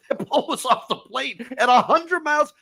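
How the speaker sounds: giddy laughing disbelief. Real, not acted. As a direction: laugh out the words in amazement. Laughs while marvelling at homer.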